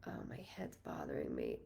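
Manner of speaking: soft whisper to herself; whispered aside. Real, not acted. Whispering to herself about her aching head. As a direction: breathe it out as a private complaint.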